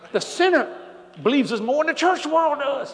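rising preacher's cry. Real, not acted. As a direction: lift your voice over the church.

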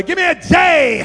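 high, loud pep-rally call; mock cheerleading. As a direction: shout it to work up a crowd. Disciples as cheerleaders.

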